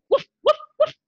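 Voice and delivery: high yappy woof woof; barking like a dog. Shown as a demonstration. Teacher barks like a dog for the class, high and playful. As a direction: bark it out like a puppy. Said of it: "And a dog barks".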